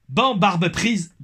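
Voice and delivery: slow, high, loud mock Québécois accent. Reading a fan's comment aloud, hammed-up Québécois accent. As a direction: read it out slowly with a put-on Québécois accent. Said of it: "mon accent québécois".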